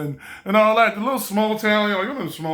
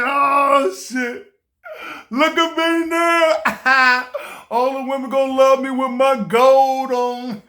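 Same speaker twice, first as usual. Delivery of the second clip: gleeful bragging; 5 semitones higher, 3 dB louder, slower than usual. Retold or imitated. Admiring himself in the gold.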